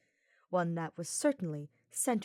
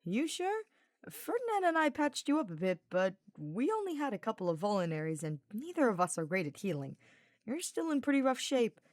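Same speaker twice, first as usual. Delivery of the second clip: lightly voiced teasing knight; a hint of a cheeky young knight, grinning; Sylvain's voice; 4 semitones higher than usual. Sylvain teasing Hubert while carrying him, lightly voiced.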